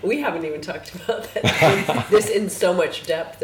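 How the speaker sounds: laughing through the words, loud and bright; delighted laugh while speaking. Real, not acted. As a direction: talk through a real burst of laughter. Laughing while praising the story.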